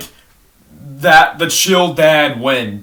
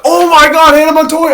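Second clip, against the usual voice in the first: loud, high-pitched squeal of recognition; shouted fangirl excitement; excited outburst; 11 semitones higher, 8 dB louder than usual. Retold or imitated. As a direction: burst out like spotting a long-lost favourite. Acted rediscovery of an old favourite.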